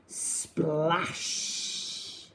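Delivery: usual speaking voice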